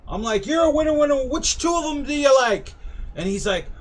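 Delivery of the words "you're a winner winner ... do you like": high singsong barker cheer; carnival barker pitch. Retold or imitated. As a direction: sell it like a carnival game host. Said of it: "my whole rigmarole".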